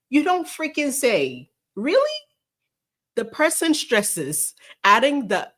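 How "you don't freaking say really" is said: drip with mock amazement; sarcastic disbelief. Real, not acted. Mocking the article's claim, sarcastic disbelief.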